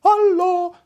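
high, ringing echo of a call. A narrator's reading. Echo answering the prince in the gorge, high echo. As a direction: throw it back like an echo. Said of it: "sagte ein Echo".

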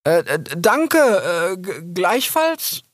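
thanks awkwardly, caught off guard; flustered fox. Acted. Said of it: "sagte Rix". (verlegener junger Fuchs, stotternd)

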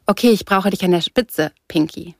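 ordinary delivery, nothing marked

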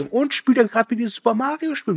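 high-pitched imitation of a parent checking in; fragender Elternteil. Reingucknder Elternteil wird zitiert. (nachgeahmte Elternstimme, hoch und fragend)

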